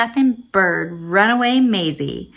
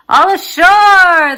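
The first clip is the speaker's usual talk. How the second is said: big slow shout, much higher and louder; shouted call; 9 semitones higher, 11 dB louder, slower than usual. Acted. Sailors shouting to passengers, a loud slow call. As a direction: bellow it to the whole ship. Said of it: "the men shouted".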